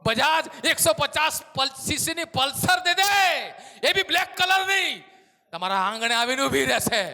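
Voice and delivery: loud, high, confident ask; bold specific request. Acted. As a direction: claim the exact bike boldly. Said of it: "તમારે એમ કેવું જરૂરી છે".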